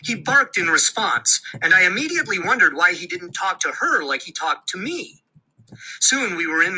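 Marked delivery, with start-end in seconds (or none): none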